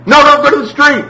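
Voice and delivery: high, very loud warning shout; urgent yell. Shown as a demonstration. Parent stopping a child heading to the street, yelling. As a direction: yell it to stop a child in their tracks. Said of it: "doesn't need to be said quietly".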